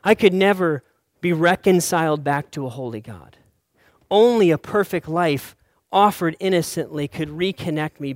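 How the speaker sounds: loud, ringing preacher's declaration; preaching at full force. Acted. Pastor to congregation about sin and mercy, loud preaching. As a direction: proclaim it from the pulpit, loud and sure.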